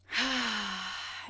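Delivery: loud open-mouthed exhale; sighing it out. Shown as a demonstration. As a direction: let the breath go in a big audible sigh. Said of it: "you can sigh it out".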